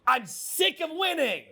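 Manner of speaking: mock fed-up. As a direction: protest in a hammed-up high voice. Acting fed up with winning.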